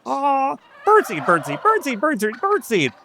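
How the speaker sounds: high squawky turkey chorus. Acted. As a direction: chant like a flock of turkeys. Turkeys chorus answering Brass.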